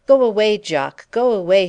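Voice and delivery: loud, high plea to go away; crying out. A narrator's reading. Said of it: "she cried".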